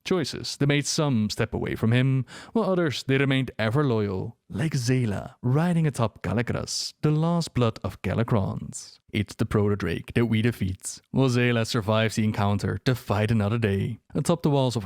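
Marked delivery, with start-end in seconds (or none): none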